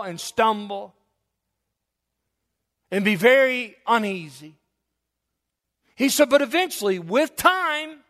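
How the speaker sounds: high, loud pulpit fervor; full-throated sermon climax; preaching at full force. Acted. Preaching builds.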